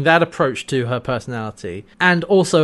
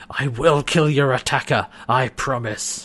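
solemn mock vow. Scorpius kneeling over Sikosa, mock-solemn vow. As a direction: swear it like a melodramatic hero.

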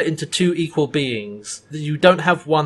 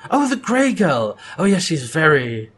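affable villain. Retold or imitated. Imitating affable evil Karvok.

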